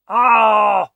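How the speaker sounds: sudden loud yelp of surprise; real frustrated outburst as the plan fails; startled shout. Real, not acted. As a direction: caught off guard, blurt it out. Pickpocket attempt foiled at last second.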